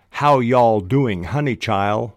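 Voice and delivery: slow, loud Southern "how y'all"; Southern accent. Shown as a demonstration. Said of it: "a character might well sound like".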